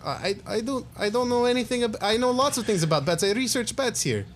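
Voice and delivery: high, flustered lab scientist; a high, cagey scientist who knows about bats; nervous scientist voice. Acted. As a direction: scientist dodging questions about his bat research. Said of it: "I'm gonna switch it up".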